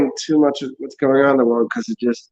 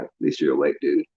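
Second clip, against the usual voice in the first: much lower, softer sarcastic aside; mock deep voice; 10 semitones lower, 5 dB quieter than usual. Mocking the awakened as doom comes, deep and soft. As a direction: drop into a deep, quiet dude voice.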